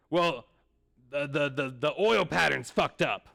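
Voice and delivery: slow, loud, sputtering complaint; angry old man. Retold or imitated. Old bowler blaming the lanes, slow loud complaint. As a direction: spit out the blame. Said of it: "he starts yelling".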